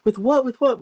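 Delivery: high, urgent mock questioning; imitated parental freak-out. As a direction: act out alarmed parents demanding answers. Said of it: "freaking out".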